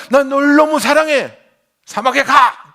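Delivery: loud, high ironic divine voice; mock God voice. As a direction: play God saying love then banishing. Mock God's contradictory words.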